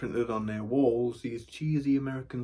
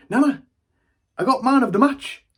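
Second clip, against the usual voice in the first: put-on loud, high child's boast; excited child; 12 semitones higher, 10 dB louder than usual. Nine-year-old telling nana about the football match, loud and excited. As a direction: shout the big news up high. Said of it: "when I was nine years old".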